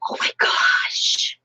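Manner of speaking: slow, breath-only gasp of amazement; awed surprise. Real, not acted. Gasping at the present for her hubster, awed surprise. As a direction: whisper it slowly, stunned by the find.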